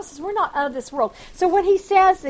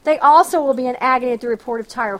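forceful scripture reading; 4 dB louder than usual. Acted. Preacher proclaiming Isaiah's oracle on Egypt to the congregation, forceful reading. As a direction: proclaim the verse, a touch louder.

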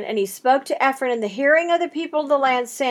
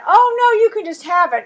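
higher, hammed-up giveaway; a seller faking kindness, pitched up; mock generous seller; 8 semitones higher, 6 dB louder than usual. Seller feigns generosity in analogy.

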